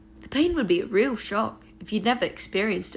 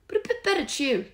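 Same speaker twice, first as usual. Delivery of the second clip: plain reading voice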